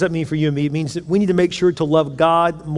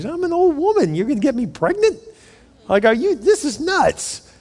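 put-on much higher incredulous old-woman voice; hammed-up Sarah, scoffing, pitched way up; 11 semitones higher than usual. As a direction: squeak out disbelief like an old woman. Sarah voiced disbelieving the promise.

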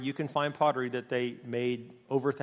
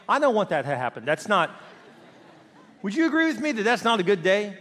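usual speaking voice

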